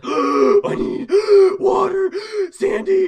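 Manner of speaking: very high, loud, slow gasping creature voice; gasping squid. Acted. Tiny squid begging for water, high and gasping. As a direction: gasp the words out like a dying little squid. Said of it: "He basically says".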